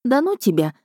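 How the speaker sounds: frowning. A narrator's reading. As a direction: brush it off with a touch of annoyance. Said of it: "нахмурилась она".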